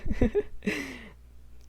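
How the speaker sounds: bright embarrassed giggle; short high laugh after a slip. Real, not acted. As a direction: giggle at your own confession. Laughs at own story.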